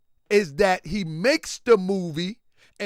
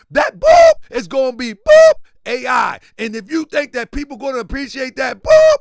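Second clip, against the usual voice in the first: loud, high angry outburst; angry rant; 5 semitones higher, 11 dB louder than usual. Retold or imitated. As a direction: rant like a furious friend. Friend cussing angrily.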